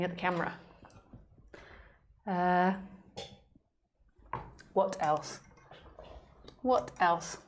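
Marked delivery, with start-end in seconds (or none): none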